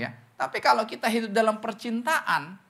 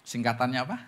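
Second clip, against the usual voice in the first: usual speaking voice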